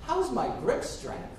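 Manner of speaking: high, slow baffled repeat of a question; puzzled echo. Acted. Bob echoes girlfriend's odd question, slowly puzzled. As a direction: repeat it back, drawn out in disbelief.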